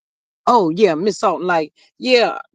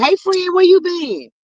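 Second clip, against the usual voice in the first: playful greeting; 8 semitones higher than usual. Retold or imitated. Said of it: "I'm saying for Miss Maria".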